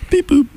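very high chirpy robot beep; beep boop sound. Real, not acted. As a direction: squeak it like a tiny droid. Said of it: "Nothing's original in this world".

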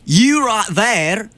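drawn-out Scottish teasing; Scottish accent. Acted. Host teases his co-host about Stitch in a slow, high Scottish accent. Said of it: "someone from Braveheart".

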